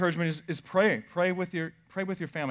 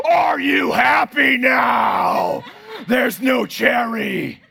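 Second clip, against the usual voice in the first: loud, slow, high taunting yell; dad yelling at daughter after tossing the cherry; mock shout; 7 semitones higher, 9 dB louder, much slower than usual. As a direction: drag it out in a mock rage. Dad yells after throwing cherry.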